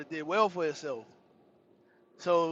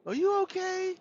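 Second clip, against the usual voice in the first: high-pitched imitation of a concerned woman; 11 semitones higher than usual. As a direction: raise it high for her question.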